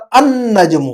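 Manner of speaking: النجم as an-najmu, with a long held N; assimilated article. Shown as a demonstration. Teacher corrects pupil's reading, النجم with long humming N. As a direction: drop the L in النجم and lean on the doubled N. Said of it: "تو اب ہم پڑھیں گے یہاں پر".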